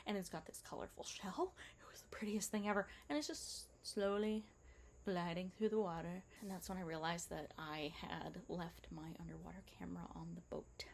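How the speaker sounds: whispered wonder over a turtle; hushed awe. Real, not acted. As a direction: hush it like the turtle might hear. Underwater turtle, awestruck.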